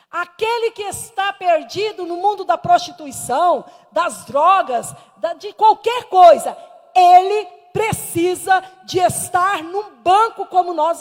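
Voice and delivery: loud pulpit declaration; emphatic preaching. Real, not acted. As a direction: stretch the key words and drive them home.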